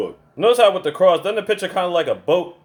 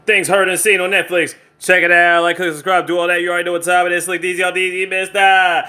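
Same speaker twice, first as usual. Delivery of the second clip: loud, raised-pitch announcer outro; hyped sign-off; 4 semitones higher, 5 dB louder than usual. Acted. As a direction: rattle through the channel plug on one high, held note. Signs off the video.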